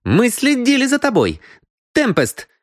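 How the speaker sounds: high-pitched woman's outcry; accusing exclamation. Acted. Мисс Киан exposes the cheat and calls Темпест, exclaiming. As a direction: call out high, exposing him.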